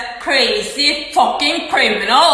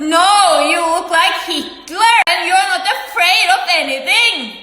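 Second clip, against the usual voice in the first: raised, higher voice in heated denial; angry retort; 6 semitones higher than usual. Retold or imitated. Speaker rejects the other's claim of being afraid, angry retort. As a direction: burst out against the claim.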